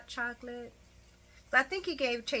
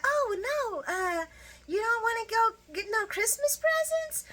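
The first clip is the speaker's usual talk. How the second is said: much higher sing-song coaxing; coaxing Brooklyn; 13 semitones higher than usual. Retold or imitated. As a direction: coax the boy in a high voice. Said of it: "she tries to confront him like".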